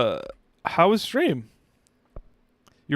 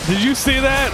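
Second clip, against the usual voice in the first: high, louder repeat, stress on see; even more thrilled, pushing see; very excited; 11 semitones higher, 4 dB louder than usual. Real, not acted.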